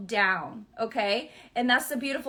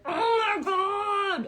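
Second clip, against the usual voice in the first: exasperated; 10 semitones higher, 3 dB louder, much slower than usual. Retold or imitated. Imitating people feeling blocked.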